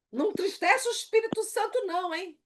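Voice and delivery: acted-out preachy telling-off; bossy human authority. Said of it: "e eu boto assim no zap".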